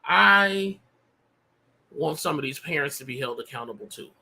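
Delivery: hoarse, worn-out voice; losing voice. Real, not acted. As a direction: voice giving out, hoarse. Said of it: "I'm losing my voice".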